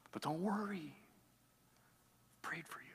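hushed whisper, slow on the last words. Retold or imitated. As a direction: breathe it softly like a secret promise. Jesus reassuring Peter, quoted.

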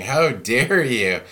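drawn-out, slowed, offended pearl-clutcher; mock outrage. To the pastor who rebuked her, mock indignant. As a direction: play the scandalised church lady, dragging it out. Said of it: "clutching your pearls and going".

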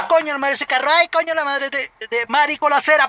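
panicked. Retold or imitated. Reliving near crashes.

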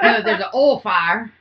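laughing, "oil" stretched into one Southern vowel. Shown as a demonstration. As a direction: say "oil" flat like "ol" in a Southern drawl, slow. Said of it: "I say soil and oil".

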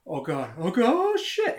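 loud imitation, climbing to a high wail; mounting panic. Kid as the lights go out, acted-out escalating panic. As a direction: act out terror rising fast in pitch. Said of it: "He's afraid of the dark".

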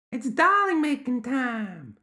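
put-on very high, slow child-creature squeal; firm gremlin child. A narrator's reading. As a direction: drag it out in a high, insistent child voice.

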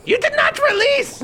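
very high scolding shriek; screeching witch. Acted.

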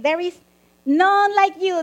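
high, loud ringing declaration; proclaiming. Acted. Preacher reading Jeremiah's praise to God, loud exultant proclamation. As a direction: lift it up in praise. Scripture praise, rising acclaim.

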